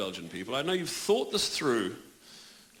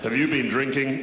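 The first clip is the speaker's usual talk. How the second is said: police officer; 4 semitones lower, 5 dB louder than usual. Retold or imitated. Police stop in an ad.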